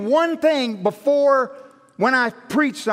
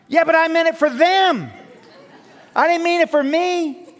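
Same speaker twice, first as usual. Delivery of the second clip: whiny protest; 6 semitones higher, 4 dB louder than usual. Retold or imitated. Protesting to God in prayer.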